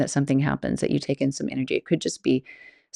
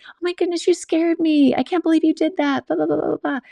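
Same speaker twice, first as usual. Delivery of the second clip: much higher and louder, playful scolding; mock scolding shriek; 14 semitones higher, 3 dB louder than usual. Scolding the masked man she thinks is a friend, high playful shriek. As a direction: throw the energy right back, high and loud. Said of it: "coming back with".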